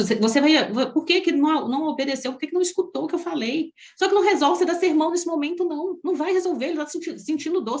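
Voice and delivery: usual speaking voice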